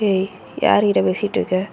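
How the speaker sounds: low, gruff demand from a big brother; a brother barging in, suspicious; stern older brother. Acted. Brother storming into sister's room, low and stern. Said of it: "உங்க அண்ணன் ... ரூம்குள்ள வரான்".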